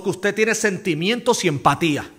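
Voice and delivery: ordinary delivery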